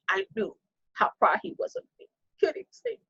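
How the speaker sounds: high, soft, voice close to breaking; barely getting the words out, moved; choked up. Real, not acted. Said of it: "I'm sorry, y'all".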